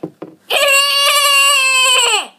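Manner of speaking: high shrieking mock baby laugh; loud high imitation of a baby's laugh; imitated baby laugh. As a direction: shriek out a nephew's hysterical laugh. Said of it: "that's his laugh".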